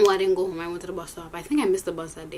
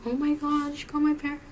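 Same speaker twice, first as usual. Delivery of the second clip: pretend shock; 6 semitones higher than usual. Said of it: "So I had to pretend now".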